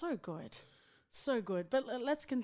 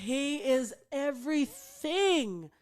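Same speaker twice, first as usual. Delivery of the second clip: slow, drawn-out swoon, pitched up; gushing adoration; 5 semitones higher, much slower than usual. Real, not acted. Fan agreeing about favourite actor, gushing. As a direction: savour every word like a besotted fan.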